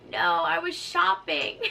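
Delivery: high, loud, drawn-out mock whine; groggy protest. Patient woken from a dream, groggy protest.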